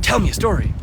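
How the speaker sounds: imitating a pushy viewer; mock demand. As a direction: play the pushy asker. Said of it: "someone's just like".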